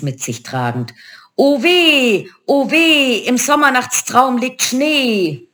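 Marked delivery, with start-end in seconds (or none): 1.3-5.5 high, drawn-out witchy lament; keening crone lament, pitched high; wailing witches. A narrator's reading. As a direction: wail woefully like old witches. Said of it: "klagten sie".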